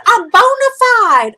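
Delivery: high, loud triumphant boast; hammed-up excited declaration; over-the-top excitement. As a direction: proclaim it with giddy pride. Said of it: "I got so excited and clapped".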